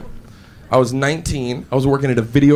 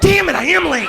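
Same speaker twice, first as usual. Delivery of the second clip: high-pitched cry of frustration; exasperated outburst; 11 semitones higher than usual. Acted. Sleeper sees the clock and knows he is late, exasperated outburst. Looks at clock, realises lateness.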